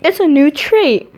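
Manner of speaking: high loud proud cry; excited exclaim. A narrator's reading. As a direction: announce it with glee. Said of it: "Papyrus exclaims".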